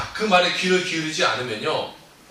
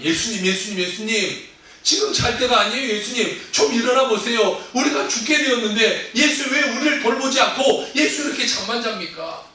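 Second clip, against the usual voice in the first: louder, higher urgent pleas to wake him; panicked pleading; 6 semitones higher, 5 dB louder than usual. Acted. Disciples in the sinking boat shaking Jesus awake, frantic. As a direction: call out in a rising panic.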